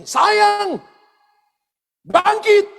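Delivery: high, loud call to raise her; calling out to the dead. Retold or imitated. Healer calling his dead wife to rise, loud and high. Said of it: "lalu dia katakan begini".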